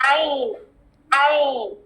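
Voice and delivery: عین said with the squeezed middle-throat ayn; ayn sound demo. Shown as a demonstration. Teacher demonstrating the ayn letter, throaty articulation. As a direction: tighten the mid throat on عین. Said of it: "اس کی sound کیسی ہے؟".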